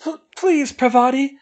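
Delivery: high, hitching tearful plea; a crying girl begging her friend to leave; voice hitching with tears. A narrator's reading. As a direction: plead through catching sobs. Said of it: "her voice hitching with tears".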